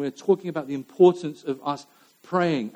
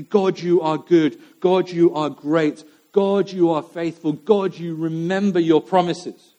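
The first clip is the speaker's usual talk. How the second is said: slow, louder, earnest praise to God; prayer voice; 3 semitones higher, 5 dB louder, slower than usual. Acted. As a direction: lift each line slowly toward God. Voicing Nehemiah's opening praise.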